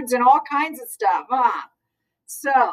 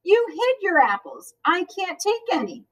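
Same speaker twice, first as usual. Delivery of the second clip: yelling; 8 semitones higher than usual. A narrator's reading. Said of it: "yelled Janet".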